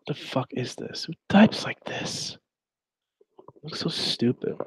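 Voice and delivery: whispered in disbelief, high on the question; disgusted whisper. Real, not acted. Streamer whispering in disgust at the chat log. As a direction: hiss it in disgust at the screen.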